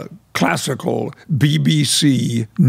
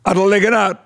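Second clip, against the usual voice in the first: put-on broad old Yorkshire greeting, higher and louder; Yorkshire dialect; 6 semitones higher, 4 dB louder, faster than usual. Shown as a demonstration. Greeting a friend at his door in broad Yorkshire. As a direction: call it at the door in thick Yorkshire. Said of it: "there's a very simple one".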